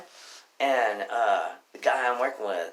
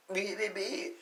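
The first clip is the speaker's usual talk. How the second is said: high imitated electronic beeps; card machine beeps; 10 semitones higher, 5 dB quieter, slower than usual. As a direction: beep like a card reader.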